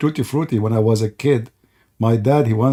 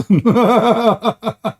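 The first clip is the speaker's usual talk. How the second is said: high laugh; 7 semitones higher than usual. Real, not acted. Laughing at own joke.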